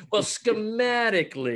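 high, loud laughing reply. Real, not acted. Guest answering the host's Bucks joke, laughing. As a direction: still laughing as he answers back.

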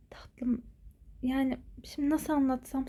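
soft confiding whisper. Acted. Mother telling Aylin's friend a secret, whispered. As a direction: hush it like a secret.

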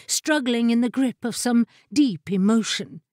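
plain reading voice

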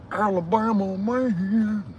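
Alabama man voice. Pretend. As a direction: call it out like a Southern catchphrase. Catchphrase called out in a voice.

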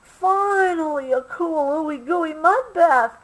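high, excited cry of delight; joyful shout. A narrator's reading. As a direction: shout it out, finally found. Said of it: "Obie shouted".

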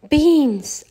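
high, loud, proud cry of beans; Mickey proudly showing off his beans; proud announcement. A narrator's reading. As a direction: announce it like a prize. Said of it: "announced Mickey proudly".

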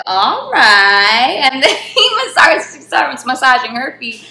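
loud laughter breaking through the words; laughing. Real, not acted. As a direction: laugh out loud as you tell it. Laughing while retelling massage.

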